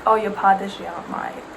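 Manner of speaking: normal talking voice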